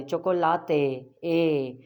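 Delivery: slow चोकोलाते, then ए alone as "ay"; Spanish final E. Shown as a demonstration. Teacher isolates ending for learners, slow चोकोलाते, ए. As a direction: stretch the "tay" of चोकोलाते and echo ए. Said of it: "ए की आ-, sound एंड में".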